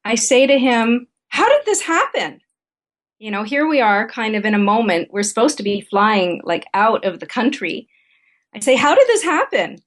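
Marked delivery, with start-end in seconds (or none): 1.3-2.4 high-pitched exasperated demand; frustrated question, pitched well up; irritated, protesting. Retold or imitated. Wife to husband at the airport check-in, irritated and protesting. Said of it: "Kind of in that sort of voice that I'm using right now". 8.8-9.8 high, protesting question; irritated, frustrated. Retold or imitated. Wife demanding answers of husband about her booking, irritated. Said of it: "Irritated and frustrated".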